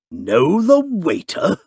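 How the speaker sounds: indignant club man, affronted. A narrator's reading. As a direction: bristle at the very idea. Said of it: "answered Mister Audley indignantly".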